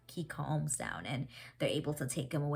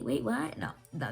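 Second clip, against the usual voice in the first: ordinary talk, nothing marked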